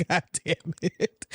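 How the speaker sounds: usual speaking voice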